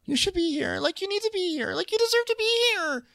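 very high, breathy-sounding mock voice; high put-on pleading; imitating Candace. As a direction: coo it high and sweet. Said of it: "Candace is like".

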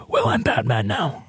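low, soft gravelly tough-guy growl; lightly mock a gruff superhero voice; Batman voice. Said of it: "You just wanna go".

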